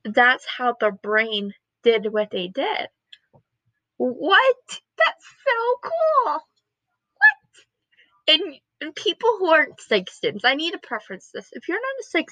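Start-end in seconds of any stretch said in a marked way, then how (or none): 4.0-8.3 very high, thrilled disbelief; amazed squeal. Acted. Student marveling at how the brain works, excited squeal. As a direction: squeal it, drawing out the cool.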